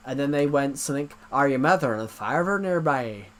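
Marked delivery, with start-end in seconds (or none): 1.3-3.3 imitating an Irish scammer's question; Irish-accented phone caller. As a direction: put on a broad Irish accent. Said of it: "I can do the Irish accent pretty well".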